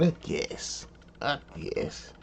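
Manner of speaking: whispered grudging aside. Real, not acted. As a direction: breathe it out under your breath.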